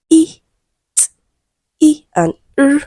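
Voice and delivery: "E", "T", "E", "R" as separate sounds, with pauses between; isolated speech sounds. Shown as a demonstration. Teacher counting rhyming sounds from the last, each of "E", "T", "E", "R" sounded separately. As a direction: sound out "E", "T", "E", "R" one by one, the vowel pitched high. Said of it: "four sounds that rhyme from the last".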